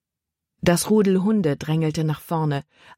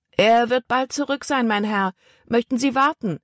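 put-on higher-pitched, courteous young deckhand; polite boy; 7 semitones higher than usual. A narrator's reading. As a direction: stall the man politely. Boy politely stalls the man. (höflicher Junge, hohe Stimme)

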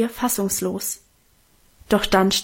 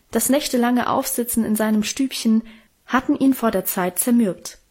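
ordinary delivery, nothing marked

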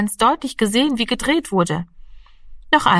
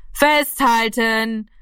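calling out; 3 semitones higher, much slower than usual. Acted. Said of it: "rief Gaeto".

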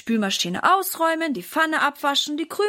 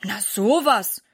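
langsam, erstaunt gedehnt; 4 dB louder, slower than usual. A narrator's reading. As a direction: verwundert den Kopf schütteln. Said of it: "Papa schaut erstaunt". (Papa wundert sich über die Kinder, langsam erstaunt)

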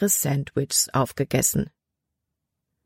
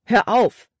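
sharp raised command to stop; commanding order; 6 semitones higher, 4 dB louder than usual. A narrator's reading. Boy ordering a sobbing girl to stop crying, commanding. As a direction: order her to stop, firm and louder. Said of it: "befahl Caleb".

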